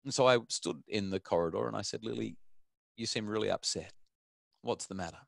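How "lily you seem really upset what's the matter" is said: soft, careful fatherly check-in; gentle concern. Retold or imitated. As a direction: softly ask an upset child what's wrong. Father gently addresses upset daughter.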